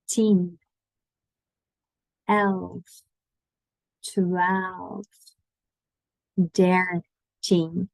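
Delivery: numbers drawn out one at a time; slow counting. Shown as a demonstration. Teacher inviting students to repeat the numbers, slowly. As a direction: leave a long pause after each number. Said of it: "I'm gonna say them slowly".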